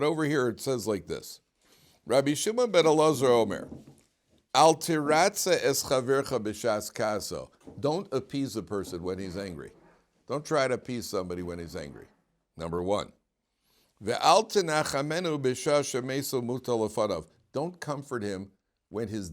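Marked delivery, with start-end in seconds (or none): 2.1-7.5 Mishnah recitation. Shown as a demonstration. Chanting the Mishnah text. 14.0-17.3 Mishnah recitation. Shown as a demonstration. Chanting the Mishnah text.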